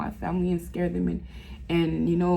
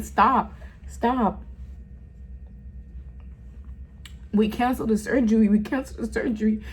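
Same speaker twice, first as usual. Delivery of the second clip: higher and fast urgent orders; urgent doctor; 4 semitones higher than usual. Retold or imitated. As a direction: rush the orders like a doctor in a hurry. Doctor cancels surgery in hallway.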